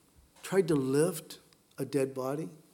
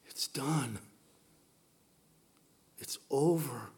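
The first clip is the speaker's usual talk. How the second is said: hushed grief; 4 semitones lower, 8 dB quieter, slower than usual. Retold or imitated. Voicing grief after a death.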